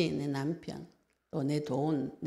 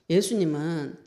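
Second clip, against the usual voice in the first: ordinary talk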